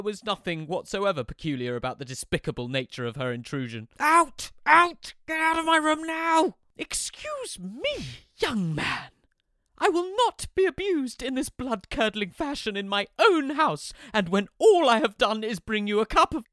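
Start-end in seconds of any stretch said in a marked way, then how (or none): 4.0-6.6 furious shout. Acted. Son yelling his mother out of his bedroom. 6.8-16.5 high, loud, affronted mother; indignant mother. Acted. Mother offended at her son's outburst, high and loud.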